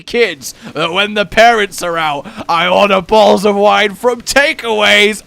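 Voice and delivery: put-on sarcastic, over-the-top sneaky kid caught out; mock guilty teenager. Customer mocking what the delivery driver assumed, hammed-up confession. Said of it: "he took that as a obvious".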